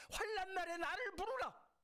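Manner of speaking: high, loud proclamation of 부르라 with a falling end; climactic cry. Shown as a demonstration. Pastor crying the verse out to the congregation, loud and high. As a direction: shout the verse as the peak of the sermon. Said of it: "함께 읽겠습니다".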